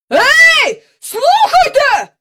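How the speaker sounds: high, drawn-out shout; hollering. A narrator's reading. Park keeper calling after the vanished carousel, indignant hollering. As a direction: shout up at the sky in outrage. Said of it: "загаласував він".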